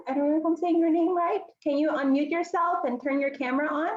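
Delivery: ordinary delivery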